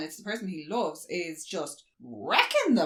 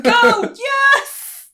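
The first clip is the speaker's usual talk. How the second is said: very high, loud comic yells; panicked shout; 14 dB louder than usual. Retold or imitated. Board members scramble for the helicopter, panicked shouts. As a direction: yell it in mock desperation.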